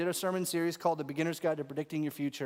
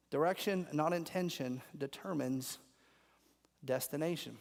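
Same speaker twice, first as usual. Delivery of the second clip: softer, lower, slowed motto; quiet restatement; 4 semitones lower, 6 dB quieter than usual. Shown as a demonstration. Preacher repeats the motto softly to the congregation. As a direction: let the motto sink in softly. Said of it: "softly restating the motto".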